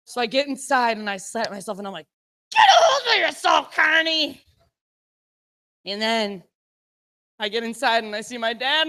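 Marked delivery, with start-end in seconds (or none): 2.5-4.4 high, strained pep talk to yourself; frantic self-scolding. Retold or imitated. Sternly scolding herself.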